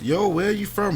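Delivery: high-pitched mock female voice; imitating the women. Imitating women curious about his origins, high mock voice. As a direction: put on the women's voice, well above your own. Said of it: "all the women out there were like".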